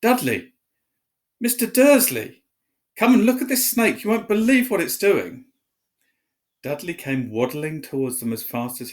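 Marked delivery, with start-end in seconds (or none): 0.0-5.5 high, loud calling across the room; excited shout. A narrator's reading. Piers shouting to Dudley and Mr Dursley about the snake. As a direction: yell for them to come look. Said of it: "a deafening shout behind Harry".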